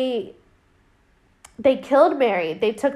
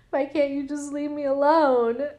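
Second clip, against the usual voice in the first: higher-pitched mock plea; pleading woman; 4 semitones higher than usual. Voices Marianne pleading with someone unseen.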